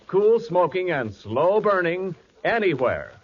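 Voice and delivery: usual speaking voice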